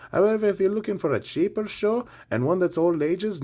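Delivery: cheesy Irish brogue read of the show listings; terrible Irish accent. Acted. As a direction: play a bad stage Irishman plugging the gigs. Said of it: "that was my terrible Irish accent".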